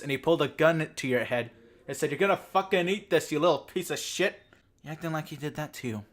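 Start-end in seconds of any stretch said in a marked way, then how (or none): 2.1-4.4 higher, louder hammed-up threat; mock gunpoint threat. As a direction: snarl it like a thug with a gun.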